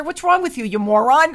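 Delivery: high, loud retold telling-off; playful mock scolding.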